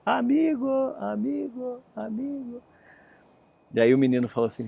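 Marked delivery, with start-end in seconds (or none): 0.0-2.6 eco agudo que vai ficando cada vez mais fraco; eco sumindo. Acted. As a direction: repita como eco que se perde na floresta. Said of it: "Aí vem a resposta". (eco da floresta responde ao menino, sumindo aos poucos)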